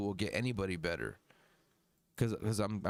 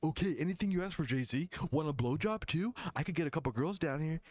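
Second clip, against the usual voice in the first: fast eager manager voice; 5 semitones higher, faster than usual. Retold or imitated. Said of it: "they're like".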